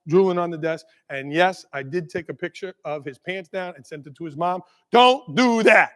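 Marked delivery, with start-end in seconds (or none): 4.9-5.9 slow, louder "Don't do that"; emphatic warning. Real, not acted. Speaker warning school leaders off his own mistake, emphatic warning. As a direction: spell out "Don't do that" word by word, forcefully. Warns audience against sending such pictures.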